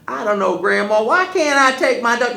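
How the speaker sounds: put-on high, loud whiny boy; pleading boy. Wiley begging Grandma to take his dog, high pleading. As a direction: plead like a scared kid.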